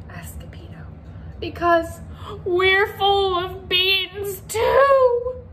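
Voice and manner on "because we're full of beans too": drawn out, high and loud; slow emphatic punchline. Acted. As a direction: land the punchline slowly. Punchline answer.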